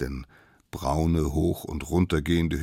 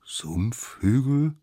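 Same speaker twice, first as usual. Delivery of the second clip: slow, raised, rising echo; doubtful question; 7 semitones higher, much slower than usual. A narrator's reading. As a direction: repeat the word in disbelief. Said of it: "fragten die anderen". (gedehnt und fragend nachgesprochen)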